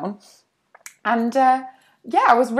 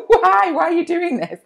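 loud, high mock wail; imitated tearful child; 9 semitones higher, 7 dB louder than usual. June upset by parents leaping about, mock tearful wail. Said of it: "burst into tears 'cause she was like".

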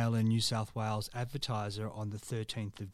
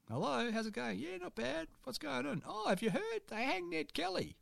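much higher, comic caller voices; old-timey phone callers; 12 semitones higher, 4 dB quieter than usual. Acted. Host acts an 1880s phone chat, high and hammy. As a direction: play two chatty callers in a high voice. Acted 1880 phone call.

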